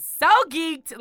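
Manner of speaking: high and loud hype; excited. Real, not acted. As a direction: burst with glee. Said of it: "I was so excited".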